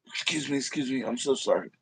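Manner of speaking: hoarse apology after coughing; strained voice after choking; choked up. Real, not acted. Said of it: "Getting choked out by the good one".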